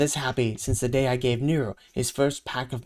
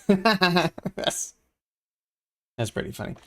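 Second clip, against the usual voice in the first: laughing; 5 semitones higher, slower than usual. Real, not acted. Reader laughs at the joke.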